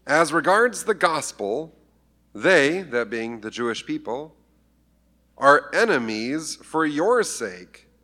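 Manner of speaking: slow emphatic reading of the verse; solemn slow reading. Shown as a demonstration. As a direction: dwell on each word of the verse. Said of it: "reading scripture slowly".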